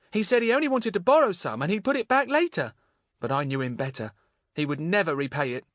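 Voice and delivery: gently voiced young girl. A narrator's reading. Voices Marion.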